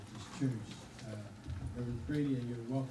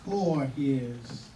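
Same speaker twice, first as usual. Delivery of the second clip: solemn, slow, lightly raised scripture reading; a touch of pulpit gravity; 4 dB louder, slower than usual. Acted. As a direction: read the verse like a preacher. Reads scripture in solemn style.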